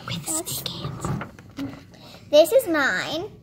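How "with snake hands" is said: slow whispered aside; hushed, drawn-out whisper. Real, not acted. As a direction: whisper it, stretching the words. Whispered detail of the drawing.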